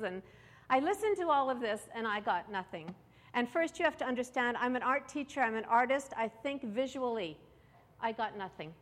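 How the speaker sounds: ordinary delivery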